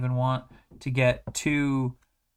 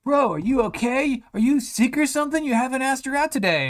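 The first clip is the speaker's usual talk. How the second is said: high, louder bro voice; mock-concerned buddy checking on him; hammed-up high classmate; worried classmate; 11 semitones higher, 4 dB louder than usual. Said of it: "the other guys in class are like".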